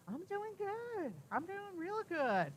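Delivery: high, slow, contented reassurance; happy Uncle Bob. Retold or imitated. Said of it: "He sounded so good, so happy".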